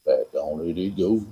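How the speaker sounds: playfully deep voice. Pretend. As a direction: drop it way down low. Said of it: "Gotta use your deep voice".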